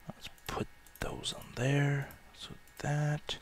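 soft unvoiced whisper. Real, not acted. Gamer placing blocks, whispering to himself. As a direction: murmur it to yourself. Quiet aside while building.

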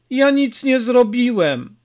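high, anxious protest of innocence; mildly scared boy defending himself; frightened stammer. A narrator's reading. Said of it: "wyjąkał". (wystraszony, cienki głos chłopca)

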